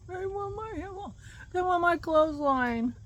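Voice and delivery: put-on high whiny little-girl voice; childish whine.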